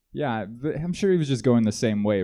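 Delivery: ordinary talk, nothing marked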